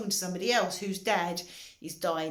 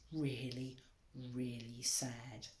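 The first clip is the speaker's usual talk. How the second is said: whisper; 6 semitones lower, 10 dB quieter, slower than usual. Real, not acted. Sad thought about suicides.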